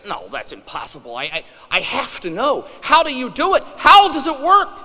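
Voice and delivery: rising panic, he has to know; hammed-up desperate man, growing to a loud high plea; flabbergasted plea.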